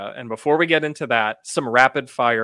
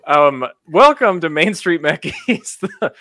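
laughing; 4 semitones higher, 5 dB louder than usual. Real, not acted. Podcast intro through laughter.

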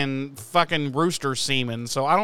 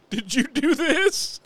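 baffled Giles; 8 semitones higher, slower than usual. Retold or imitated. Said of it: "and he goes".

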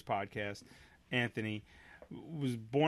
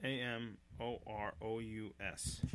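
slow, soft letter-by-letter spelling; 4 semitones lower, 8 dB quieter, slower than usual. Real, not acted. Host finishing a spelling for co-host, slow and deliberate. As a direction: spell each letter out slowly, voice dropped. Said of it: "Can you spell it out for me".

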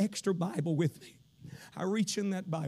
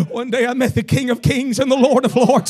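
preaching build to shout; 8 semitones higher, 18 dB louder, slower than usual. Real, not acted. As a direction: start firm, climb to a high full-force shout.

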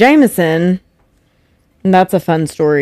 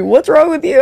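mocking repeat; 9 semitones higher than usual. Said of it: "He said that to me".

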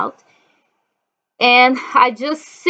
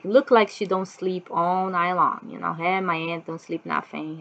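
usual speaking voice

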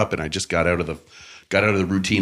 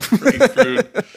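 drawn-out mock echo; 5 dB louder, much slower than usual. Mock echo of friend's word.

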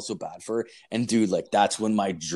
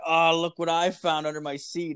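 high, sly, teasing lilt; smug reveal; 8 semitones higher than usual. Retold or imitated. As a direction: tease her with the find, voice pitched up. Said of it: "I'm like".

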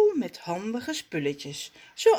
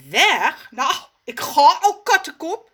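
loud, higher huffy reply; offended retort; 8 semitones higher, 8 dB louder than usual. Acted. Raf leaves Zaza in a huff, loud. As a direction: snap back in a huff. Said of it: "zegt Raf".

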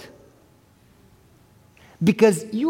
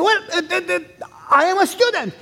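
flustered son; 9 semitones higher than usual. Retold or imitated. Son fumbling to explain.

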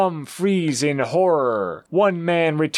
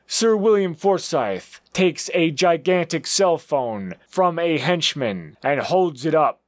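plain reading voice

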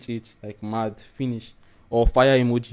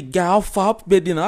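higher, louder birthday greeting; hyping up; 8 semitones higher, 5 dB louder than usual. Shown as a demonstration. Greeting a friend on their birthday in real life, hyped up. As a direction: hype your friend up out loud. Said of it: "like, I'll hype you up".

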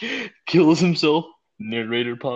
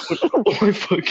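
laughing; 6 semitones higher, slower than usual. Real, not acted. Laughing through the retold line.

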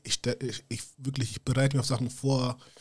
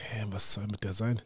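whispered, baffled retort; incredulous whisper; 4 semitones lower, 7 dB quieter than usual. Retold or imitated. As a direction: hush it like you can't believe the question. Retold disbelief at Chris's question. (ungläubig geflüstert)

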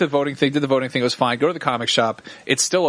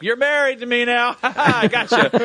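high, laughing mock gloat; 10 semitones higher, slower than usual. Trapping Jen in the hole, laughing gloat.

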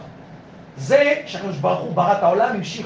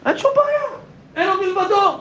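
high, ringing sermon voice; rising passionate preaching; fervent preaching; 8 semitones higher than usual. Acted. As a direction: preach it out with full fervour. Preaching at full force, high.

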